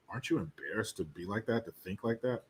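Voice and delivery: hushed, put-on voice for his question; lightly mock his calm needling; imitating Jesse Lee Peterson. Host mimics a talk-show host needling a guest, hushed. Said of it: "Jesse Lee Peterson is just fucking like".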